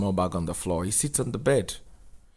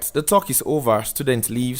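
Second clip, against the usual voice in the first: usual speaking voice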